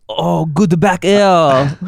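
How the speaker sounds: loud, high, stretched-out mock caster cry; hammed-up French gamer calling the move; French commentator imitation. Mocking French Melee streamers to friends, loud drawn-out impression.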